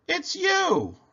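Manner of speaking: very high, merry squeal; storybook glee; amused exclamation. A narrator's reading. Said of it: "they laughed and said".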